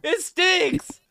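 disgusted. Real, not acted. Reacting to fart.

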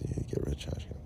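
whispered under the breath, scornful; muttered mocking echo. Mocking the police order to himself, whispered.

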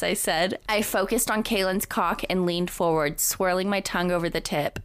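usual speaking voice

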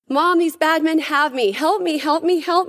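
high, pleading mock cry for help; imitating frightened pleading. Mother before a Senate committee, retelling her daughter's plea in a high, imitated voice. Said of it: "she begged and pleaded".

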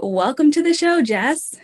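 ordinary talk, nothing marked

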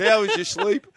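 mock innocent question. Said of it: "And they said".

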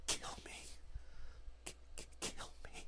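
stammering ghostly whisper, no voice at all; broken whisper. Acted. The dying flesh-woman whispers to the investigators, begging. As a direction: breathe the plea out, begging for death. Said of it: "she says".

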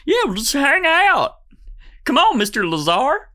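very high, loud cartoon alien voice; eager alien. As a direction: coax in a squeaky, over-friendly alien voice. Second alien invites Lazar to lunch.

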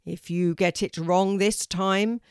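loud, weighty warning; booming tree teacher. A narrator's reading. Oak tree warns Kelty of her last try, booming. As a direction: boom out the final chance. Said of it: "said a deep, loud voice".

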